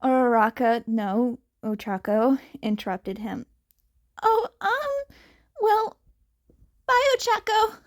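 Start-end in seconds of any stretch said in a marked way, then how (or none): 4.2-7.8 put-on higher, stammering shy boy; blushing and tongue-tied. Izuku says goodbye using her first name, flustered.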